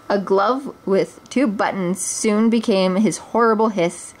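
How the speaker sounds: usual speaking voice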